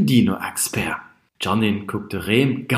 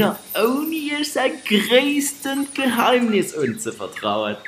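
high, slow gloating cackle; evil laugh; 12 semitones higher, slower than usual. Acted. Villainous laugh.

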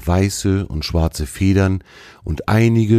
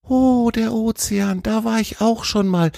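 high, excited animal-character voice; excited deer. A narrator's reading. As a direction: exclaim with delighted recognition, pitched high. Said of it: "rief Tjawe aufgeregt".

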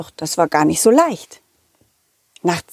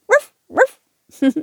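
high, playful barked ruff ruff; cheerful puppy woofs; dog barking; 14 semitones higher than usual. Acted. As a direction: bark like a little puppy. Dog Balli barks.

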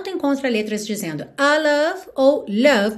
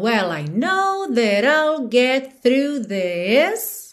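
slow, "this" held long at the end; THIS drawn out; much slower than usual. Shown as a demonstration. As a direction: stretch the final "this". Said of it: "Também alonga esse this aí no final".

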